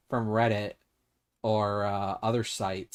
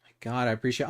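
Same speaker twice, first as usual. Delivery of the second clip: usual speaking voice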